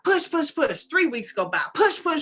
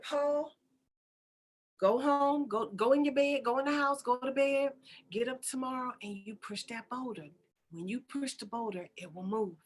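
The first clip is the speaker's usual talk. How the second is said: soft, unruffled voice of God; calm divine reassurance; 7 dB quieter than usual. Acted. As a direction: settle him down quietly. God calmly answers upset Paul.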